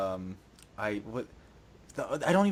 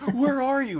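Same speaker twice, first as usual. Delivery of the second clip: mocking call; 10 semitones higher, slower than usual. Mock calling out.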